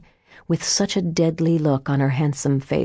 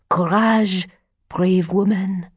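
put-on raised, rallying old man's voice; encouraging old fortune teller; 4 semitones higher than usual. A narrator's reading. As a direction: urge her on, pitch lifted. Martin encourages Adele.

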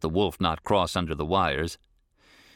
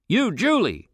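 high, loud call to a dog; 13 semitones higher, 4 dB louder than usual. A narrator's reading. Rancher calling his dog by name, high loud call. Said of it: "he called".

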